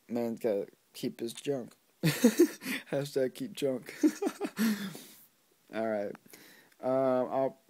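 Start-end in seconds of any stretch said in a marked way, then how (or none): 2.0-2.9 real laugh. Real, not acted. Laughing at his own punchline. 4.0-5.0 real laugh. Real, not acted. Laughing at his own punchline.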